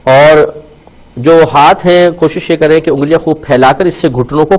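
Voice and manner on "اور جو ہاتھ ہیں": ordinary delivery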